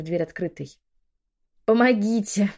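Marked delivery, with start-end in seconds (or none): 1.6-2.5 loud, higher cry with full effort; scream for help. A narrator's reading. Woman clutching her belly alone, screaming for help. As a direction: call out with all your strength. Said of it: "закричала я, что было сил".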